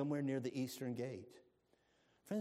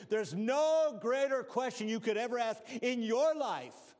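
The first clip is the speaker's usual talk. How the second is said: higher and louder pulpit preaching; preaching at full force; 7 semitones higher, 4 dB louder than usual. Acted. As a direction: drive it home to the congregation.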